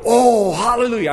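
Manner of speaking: high, loud mock joy; mock hallelujah. Preacher mocking the joyful reader of the commandments. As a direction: cheer like a happy believer. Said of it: "I don't go".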